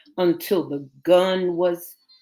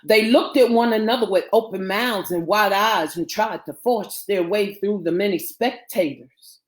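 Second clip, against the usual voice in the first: ordinary delivery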